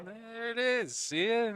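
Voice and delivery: high, loud "ta-da" delivery; triumphant reveal. Real, not acted. Co-host showing viewers the returned scoreboard, triumphant. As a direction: show it off, voice pitched right up.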